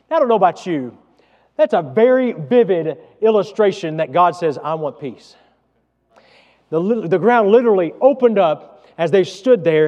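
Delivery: loud, rising preacher's delivery; preaching at full force. Acted. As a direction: preach it out to the whole congregation. Preaching to the congregation.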